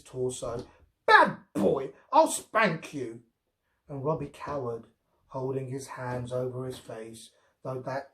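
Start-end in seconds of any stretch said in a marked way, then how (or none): 1.1-3.2 put-on high, loud little-girl scolding; mock scolding. A narrator's reading. Girl playfully scolding Robbie like a naughty dog. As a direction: pretend to be stern.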